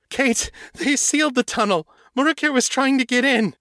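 very high, fast panicked gabble; vampire in full panic telling Kate the news; panicked rush. Acted. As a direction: spill it out in terror. Morgan in panic.

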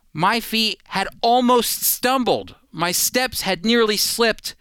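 ordinary delivery

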